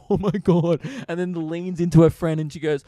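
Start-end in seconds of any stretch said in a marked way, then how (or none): 0.1-0.8 louder, higher mock gasp of disbelief; imitated shocked girl. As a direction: lightly mimic a scandalised woman. Imitating girl reacting beside him.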